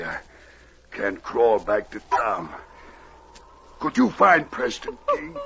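ordinary delivery

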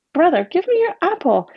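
put-on high, childlike asking; Anne-Marie asking her brother for the apple; little girl's request. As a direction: ask like a small sister. Little girl asks her brother.

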